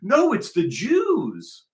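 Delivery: high, drawn-out mock wail; mocking imitation. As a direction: parrot them in a high, slow whine. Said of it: "And they go".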